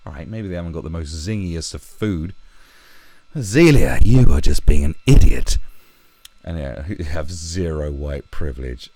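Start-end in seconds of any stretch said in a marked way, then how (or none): 3.4-5.6 shouted rebuke at full volume; loud, exasperated scolding. Real, not acted. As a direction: yell at her like a fed-up parent. Scolding the singer loudly.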